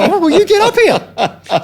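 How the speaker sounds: high, loud, drawn-out mock concession; hammed-up yell of surrender; loud retold invitation. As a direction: call him up on stage, comically giving in. Retold loud giving in, inviting him up.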